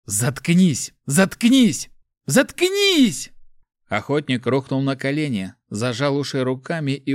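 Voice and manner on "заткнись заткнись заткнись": escalating to a high scream; each cry higher than the last; frantic, building yells to drown out a voice; desperate shouting. A narrator's reading. Mart breaks down, escalating screams.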